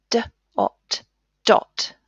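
"D, ot" split apart, then "dot" blended; segment, then blend the word; sounding out. Shown as a demonstration. Teacher showing pupils how to blend a word. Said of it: "Let's read these sounds".

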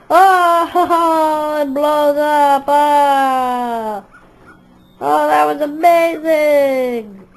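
thrilled yelling at the explosion; excited. Pretend. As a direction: cheer the blast, loud and slow. Explosion goes off.

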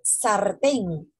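"sartén" with a firmly trilled r; strong Spanish R. Shown as a demonstration. Teacher shows students Spanish R, strongly pronounced. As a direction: roll the r in "sartén" slowly. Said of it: "Pronuncio bastante la R".

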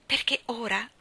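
ordinary delivery

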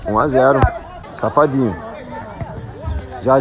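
ordinary delivery

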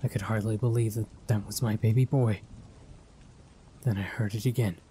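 ordinary delivery

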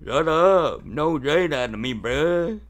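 loud mock-indignant snapping; loud, hammed-up bickering; pretend annoyed retort. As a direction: tell them off loudly, playing offended. Pretend quarrel.

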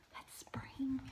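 a soft, secret whisper. Real, not acted. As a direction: let the class in on a secret. Teacher hints the answer.

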